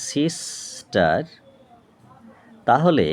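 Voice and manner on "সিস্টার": সিস্টার very slowly, halting after সিস; split into syllables. Shown as a demonstration. Teacher explaining one-push syllables to students, সিস্টার drawn out and split. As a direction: break সিস্টার into two separate pushes of sound. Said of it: "আরও একবার কথাটা বুঝিয়ে দিই".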